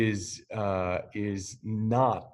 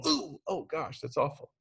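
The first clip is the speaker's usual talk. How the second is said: high wincing ooh, softly pained; sympathetic wince; 5 semitones higher, 5 dB quieter than usual. Pretend. Watching a friend stub his toe, high soft wince. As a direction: feel your friend's stubbed toe and wince. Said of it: "chances are you go".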